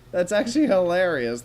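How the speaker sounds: loud and high, just after laughing; delighted outburst at a coincidence; amused amazement. Real, not acted. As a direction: exclaim it, thoroughly tickled. Still amused right after laughing.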